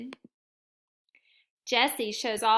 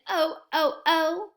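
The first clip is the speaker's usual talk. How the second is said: rising excited oh, oh, oh; 4 semitones higher, 5 dB louder than usual. Acted. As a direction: build up the excitement on each oh. Excited build on last day.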